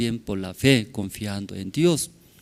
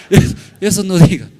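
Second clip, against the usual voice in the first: loud warning; 7 semitones higher, 10 dB louder, faster than usual. Retold or imitated. Laughing warning not to tell.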